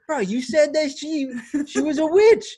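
high, loud mock accusation; indignant retort. Acting out boss's indignant retort.

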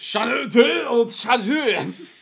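slow, loud, stumbling over "Shanae de Chazeux"; garbled slow shout of "Shanae de Chazeux"; mangled French name. Pretend. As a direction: trip over "Shanae de Chazeux" slowly and loudly. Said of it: "him falling over his words".

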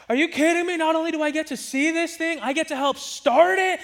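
put-on high, thrilled little-kid voice; excited kid. Boy thrilled his uncle asks for help, excited. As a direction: squeal it like an overjoyed six-year-old.

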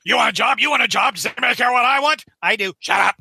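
high, rapid-fire blustering boss; J. Jonah Jameson. Acted. Newspaper boss badgers about a job, high mock bluster. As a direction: badger them, hammed-up and impatient. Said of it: "That's a good Jameson".

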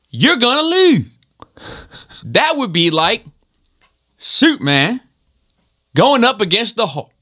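high, halting imitation of his accent; drill instructor, trailing word endings. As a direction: bark in short bursts, dropping each final syllable. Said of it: "He, like, trailed it off".

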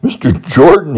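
startled, stumbling high-pitched stammer. Retold or imitated. Boy startled awake by his principal, stammering high. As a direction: trip over the name in sudden fright. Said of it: "he stammered".

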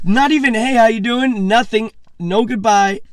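high, loud and worked up; high, loud excited rant. Real, not acted. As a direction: pile on the outrage with glee. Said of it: "retelling the stranger's silence".

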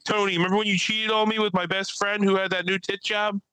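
high-pitched, needling call-out; mock female comic. Woman comic calling out her ex in the audience, high mock voice. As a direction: imitate her calling out an ex from stage. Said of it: "She's like".

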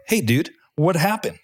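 high rising alarmed call; alarmed question. Retold or imitated. Pilot to flight engineer after finding the hole, high alarmed question. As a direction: ask in startled disbelief.

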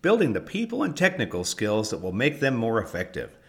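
upbeat promo announcer voice; ad read. Acted. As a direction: sell it like a radio spot. Recorded ad read.

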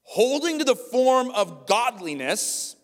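high, loud, slow preaching; preaching at full force. Acted. Pastor driving home the verse to the church, full-force preaching. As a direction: thunder the verse out.

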